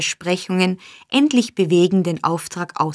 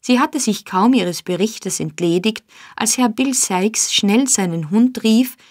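plain reading voice

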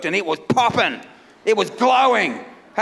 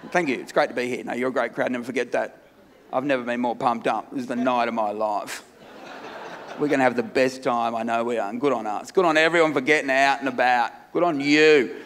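much lower, much softer, flat mock enthusiasm; sarcastic monotone; 12 semitones lower, 5 dB quieter than usual. Comic greeting the crowd, fake-pumped in a low soft monotone. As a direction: drone the hype out like you couldn't care less.

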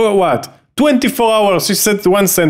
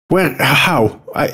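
ordinary talk

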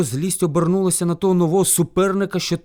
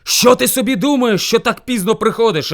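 loud, raised, accusing question; angry shouting bear; 6 semitones higher, 8 dB louder than usual. A narrator's reading. Hungry bear scolds hare for coming late, shouting. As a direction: demand an answer like a furious bear. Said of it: "кричав на нього ведмідь".

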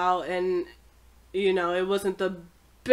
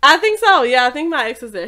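loud and giddy; 5 semitones higher, 13 dB louder than usual. Real, not acted. Laughing, loud admission.

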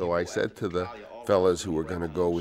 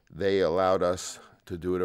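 usual speaking voice

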